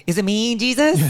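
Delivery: high-pitched, rising mock question; mocking TikTok quote. Host quoting a favourite TikTok, high mocking squeal.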